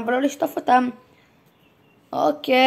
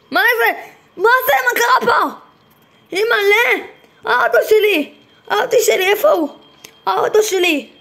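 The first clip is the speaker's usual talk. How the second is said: shocked outcry; 7 semitones higher than usual. Real, not acted. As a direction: shriek in disbelief at the wrecked car. Finds his car wrecked.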